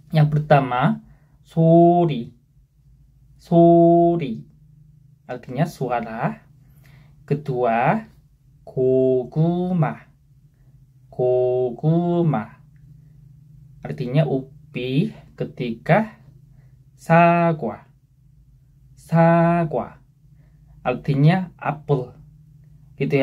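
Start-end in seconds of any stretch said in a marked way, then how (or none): 1.5-4.4 "sori" clear and louder; review demo. Shown as a demonstration. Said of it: "kita coba baca lagi". 8.7-12.7 "koguma" very slow; slow review demo. Shown as a demonstration. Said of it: "kita coba baca lagi". 17.0-20.0 "sagwa" higher and clear; review demo. Shown as a demonstration. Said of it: "kita coba baca lagi".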